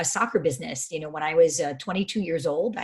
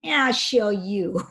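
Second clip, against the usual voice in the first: defiant retort; 4 semitones higher, much slower than usual. Retold or imitated. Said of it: "And we were like".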